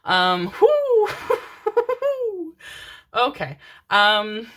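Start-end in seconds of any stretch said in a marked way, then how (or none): 1.1-3.1 very high, hooting laugh; hooting laughter. Real, not acted. Reader laughing off an ancestral scolding, hooting laughter.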